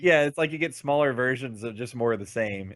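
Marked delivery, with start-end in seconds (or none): none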